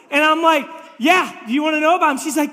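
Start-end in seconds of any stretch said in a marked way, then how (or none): 1.0-2.2 high, loud, fast eager offer; hammed-up excited pastor; eager pitch. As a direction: jump at the chance to share. Eager pastor in parody story.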